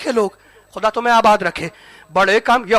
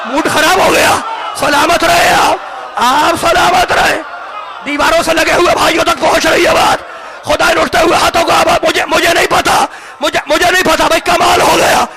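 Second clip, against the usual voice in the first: full-force preaching; 3 semitones higher than usual. Acted. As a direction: thunder the point at the crowd.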